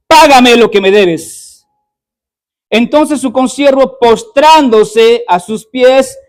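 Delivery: a preacher pouring emotion into the story; preaching at full force. Acted. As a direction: thunder the parable out to the church. Reads the parable at full force.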